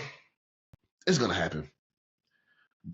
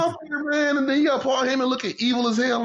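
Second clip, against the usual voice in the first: excited awe; 13 semitones higher, 4 dB louder than usual. Real, not acted. Awed retelling of scary entrance.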